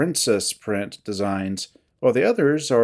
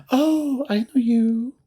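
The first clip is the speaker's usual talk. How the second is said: high-voiced giddy recognition; giddy feline; 9 semitones higher, slower than usual. Feline recognises a visitor, high and giddy. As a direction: pitch it way up, girlish and giddy.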